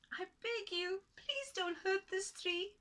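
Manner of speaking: high, slow pleading little voice; begging tiny man. Acted. Tiny man begging the woman, high and slow. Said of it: "said the tiny man".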